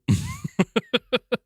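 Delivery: high, staccato laughing; rueful laugh. Real, not acted. Streamer laughing so as not to cry, rueful laugh. Laughs after sarcastic repeat.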